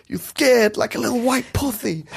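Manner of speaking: high, lisping tearful mock taunt; Mike Tyson, half crying. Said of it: "he sounds like he's gonna cry".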